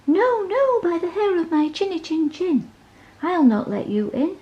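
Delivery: high, squeaky little pig, defiant. A narrator's reading. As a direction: pitch it way up like a tiny stubborn pig. Pig refuses the wolf.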